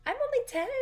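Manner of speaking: put-on high, loud little-girl voice, chirpy; imitating a little girl. Girl telling crying classmate her age, high loud child voice. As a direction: pitch way up like a ten-year-old.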